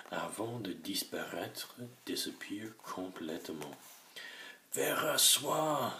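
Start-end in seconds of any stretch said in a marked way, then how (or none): 4.7-5.9 high, loud, drawn-out cry; shouted name down the drop; cried out. A narrator's reading. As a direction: call out in alarm. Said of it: "cria James".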